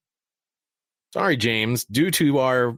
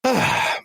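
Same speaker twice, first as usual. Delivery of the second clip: sigh; 13 semitones higher than usual. Real, not acted. Host turning to the next game, sighing. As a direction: let out a breath before moving on.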